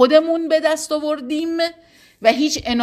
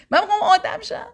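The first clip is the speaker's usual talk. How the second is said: much higher, mock self-important voice; mock proud vow; 10 semitones higher, faster than usual. Teacher mocking a prideful believer to students, high mock-smug voice. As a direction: parody a smug believer boasting.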